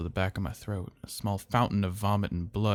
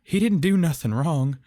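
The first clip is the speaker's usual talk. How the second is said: higher, louder frantic pleading; desperate plea; 8 semitones higher, 8 dB louder than usual. A narrator's reading. Miss Lewis begging the father to stop, frantic plea. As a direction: beg for the boy's sake.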